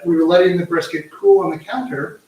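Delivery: ordinary talk, nothing marked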